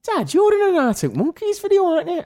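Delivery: high mock young girl voice; daughter's voice. Dad retells daughter asking about the video, high girl voice. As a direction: lightly mimic a teenage daughter.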